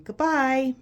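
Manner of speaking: calling goodbye to a friend; kid saying goodbye. Retold or imitated. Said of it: "is saying".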